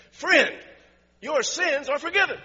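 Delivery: loud, high proclamation of "forgiven"; proclaiming Jesus. Acted. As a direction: ring it out like Jesus pronouncing pardon. Jesus's words voiced in sermon.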